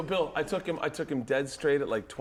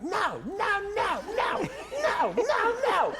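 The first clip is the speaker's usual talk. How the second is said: very high cries from far behind; mock panicked yelling; slower than usual. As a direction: yelp in protest while being dragged along. Friend yelling from behind the boat.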